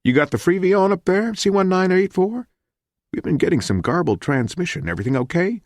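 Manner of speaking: high-pitched radio operator; high, chatty dispatcher voice. A narrator's reading. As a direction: check in on the flight over the radio. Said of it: "the voice said cryptically".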